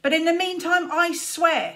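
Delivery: put-on a boy spitting a furious vow; spat in anger. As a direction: bite the words out furiously. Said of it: "he spat".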